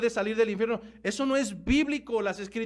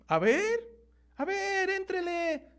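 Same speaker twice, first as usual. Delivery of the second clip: high, drawn-out daring invitation; mock challenge; 8 semitones higher, slower than usual. Preacher voices listeners daring him to tackle the rapture, mock challenge. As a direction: teasingly egg them on in a high voice.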